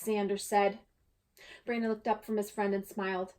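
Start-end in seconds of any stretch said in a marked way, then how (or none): none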